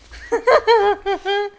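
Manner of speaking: big laugh; loud burst of laughter. Real, not acted. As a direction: crack up laughing. Laughs at Ginny's line.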